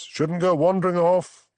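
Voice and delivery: mock-cheery posh gent, pitched well up. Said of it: "putting on his posh voice".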